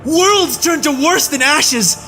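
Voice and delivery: very high furious scream, building to the end; screaming in rage. A narrator's reading. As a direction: scream the horrors at her. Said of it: "Farnes screamed".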